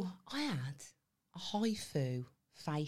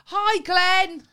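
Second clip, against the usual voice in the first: big, high, hammed-up hello to Glenn; loud mock greeting; 12 semitones higher, 14 dB louder than usual. Mock-greeting Glenn on the podcast, hammed-up loud call. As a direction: call it out much higher and louder, larger than life.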